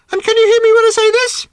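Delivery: loud, piercingly high bird voice; very high pitch. A narrator's reading. Bird testing a girl's hearing range, extremely high-pitched. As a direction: squeal it out far above your normal pitch. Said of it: "at a much higher pitch".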